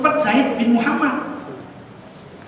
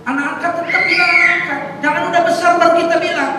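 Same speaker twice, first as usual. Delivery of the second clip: fast, high, loud preaching; loud exhortation; 7 semitones higher, 6 dB louder than usual. Real, not acted. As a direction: warn the congregation at full force. Preacher exhorting congregation loudly. (khotbah keras dan berapi-api)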